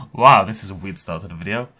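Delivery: normal talking voice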